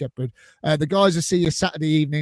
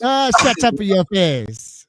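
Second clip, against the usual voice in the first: slow, hammed-up Italian accent, a bit louder; mock Italian accent; 3 semitones higher, 5 dB louder than usual. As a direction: drawl the catchphrase in a jokey Italian accent. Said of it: "you lot doing the Italian accent".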